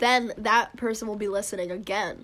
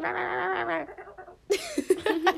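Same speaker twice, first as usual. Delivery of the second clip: high squeaky babble imitation; mocking imitation; 9 semitones higher, much slower than usual. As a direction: mimic someone babbling, pitched way up. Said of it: "It's like".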